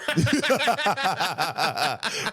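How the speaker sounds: real high-pitched laughter; high cackling laugh. Real, not acted. Storyteller after confessing his cruel comeback, cackling.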